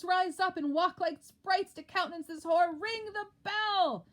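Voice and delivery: high, loud calls to wake the house; raising the alarm. Acted. Macduff rousing the sleepers with shouted alarm. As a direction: shout the alarm through the castle. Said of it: "Do not bid me speak".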